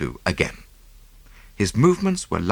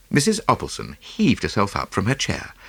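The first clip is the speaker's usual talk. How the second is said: usual speaking voice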